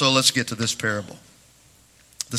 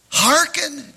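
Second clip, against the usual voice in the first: Hearken called out high and ringing, a falling preacher's cry; preached quote; 12 semitones higher than usual. Acted. Pastor quoting Jesus to the church, ringing preached Hearken. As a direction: ring out Hearken like a prophet. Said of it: "Jesus says".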